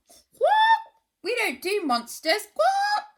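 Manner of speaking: very high, loud squawks; squawking bird. Acted. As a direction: screech like a parrot. Rainbow birds squawking.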